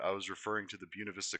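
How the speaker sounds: ordinary delivery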